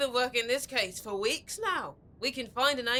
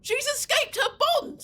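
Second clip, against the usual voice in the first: high, loud cry of alarm; alarmed shout; 12 semitones higher, 5 dB louder than usual. Acted. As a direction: warn everyone at once, shouting. Alarm at the escape.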